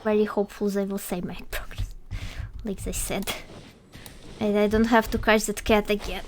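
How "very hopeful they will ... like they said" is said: fast hopeful whisper under the breath. Real, not acted. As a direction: whisper it quickly, crossing fingers.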